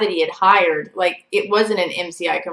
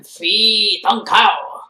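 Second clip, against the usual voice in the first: slow, drawn-out imitation of a Vietnamese accent; mock heavy accent; 4 dB louder, much slower than usual. Storyteller mimicking her stalker saying his name, slow mock Vietnamese accent. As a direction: say it like him, heavily accented and stretched out. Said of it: "That was him".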